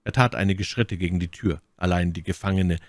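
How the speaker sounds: ordinary delivery, nothing marked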